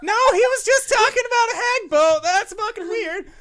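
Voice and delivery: loud, high, fast protest through laughter; laughing outburst. Acted. Game master voicing the player's second thought, laughing outburst. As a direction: burst out incredulous and laughing.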